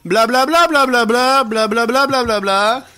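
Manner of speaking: looping spiel. Retold or imitated. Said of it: "C'est ça en boucle".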